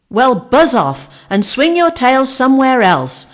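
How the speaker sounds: loud, high grumpy brush-off. A narrator's reading. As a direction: tell her to buzz off, grumpily. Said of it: "Archie said grumpily".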